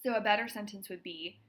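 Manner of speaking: ordinary delivery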